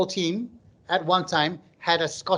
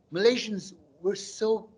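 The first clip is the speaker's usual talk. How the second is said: normal talking voice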